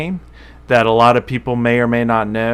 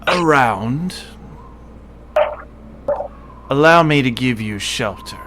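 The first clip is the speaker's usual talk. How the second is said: lightly voiced tiefling, measured; smiling tiefling stranger. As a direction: offer shelter through a sly curved smile. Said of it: "a curved smile. And he says".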